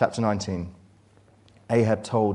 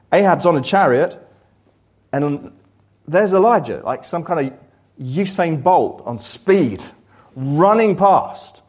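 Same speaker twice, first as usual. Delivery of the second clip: preaching at full force; 7 semitones higher, 9 dB louder than usual. Acted. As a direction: drive the story home to the congregation. Preacher building to a climax.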